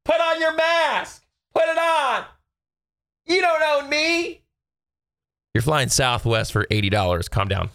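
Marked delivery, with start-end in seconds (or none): 0.1-4.4 much higher, slow mocking screech of an angry passenger; shrill mock Karen. Mocking a mask fight passenger in a very high put-on voice. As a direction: squeal it like an unhinged passenger. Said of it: "people are like".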